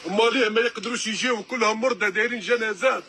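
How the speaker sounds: usual speaking voice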